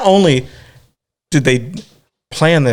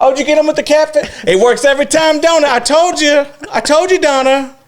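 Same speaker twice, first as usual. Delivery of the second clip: gossipy neighbor voice; 4 dB louder than usual. Retold or imitated. Neighbor bragging to Donna at the clothesline, much higher and louder. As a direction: cackle it like a gloating neighbor lady.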